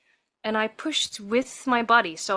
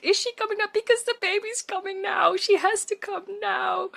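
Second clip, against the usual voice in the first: panic; 11 semitones higher than usual. Retold or imitated. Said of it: "I screamed in between".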